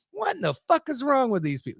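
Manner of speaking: high-pitched, incredulous outburst; exasperated disbelief. Retold or imitated. Storyteller reliving his walk away from her, high exasperated disbelief. Acts out his bewildered reaction.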